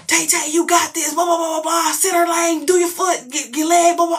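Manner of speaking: high-pitched yelled coaching; cheering yell. Retold or imitated. Parent yelling to her bowler from lane side, cheering yell. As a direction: holler encouragement in a high, carrying voice. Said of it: "I go over there and I yell".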